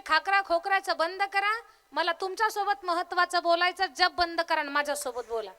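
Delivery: scolding wife. Acted. Wife ordering husband to stop chanting, loud scolding.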